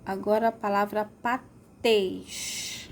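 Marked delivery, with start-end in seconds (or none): 1.2-2.9 "patês" split, long TÊS; pause, then stretch TÊS in "patês"; "patês" slowly, closed ê stressed at the end; stressed final syllable. Shown as a demonstration. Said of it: "tês é a sílaba mais forte".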